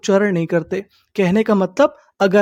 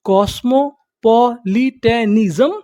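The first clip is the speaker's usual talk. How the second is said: कॉस्मोपॉलिटनिज्म slowly in separate syllables, rising at the end; slow teaching breakdown of कॉस्मोपॉलिटनिज्म; much slower than usual. Shown as a demonstration. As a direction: sound out कॉस्मोपॉलिटनिज्म piece by piece. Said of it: "उसे हम लोग क्या बोलते".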